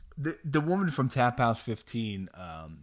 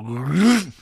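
loud, raised rasping noise; imitated back crunch; 4 semitones higher, 7 dB louder than usual. Host describing injury on the stairs, imitated crunch. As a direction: voice the sound of a back giving out. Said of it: "felt something go".

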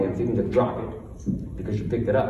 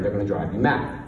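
usual speaking voice

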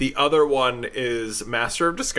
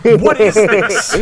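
put-on outraged girlfriend; 10 semitones higher, 12 dB louder, much slower than usual.

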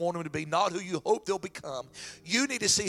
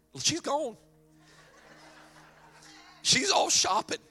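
much higher mock voice, a bit louder; mock comment about wife; 10 semitones higher, 7 dB louder, slower than usual. Husband mocking his wife's quick exit, high teasing voice. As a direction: tease her in a high put-on voice. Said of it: "and I'll go".

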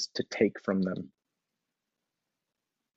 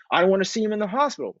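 much higher, flustered stammering parent; worked-up parent; 10 semitones higher, faster than usual. Retold or imitated. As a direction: defend yourself, rattled and high. Parent answering why they yelled.

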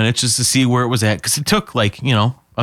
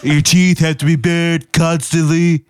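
Trump impression; 4 semitones higher than usual. Shown as a demonstration. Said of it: "It's all about the lips".

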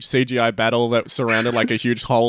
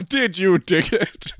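gang leader voice; 8 semitones higher, much slower than usual. Acted. Host voices Cyrus asking gangs.

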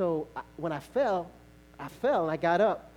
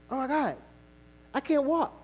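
higher, rising alarm; panic; 9 semitones higher, 3 dB louder than usual. Retold or imitated. Injured dancer realising he can't walk, alarmed. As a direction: realise you can't stand, half-panicked. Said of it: "I was like".

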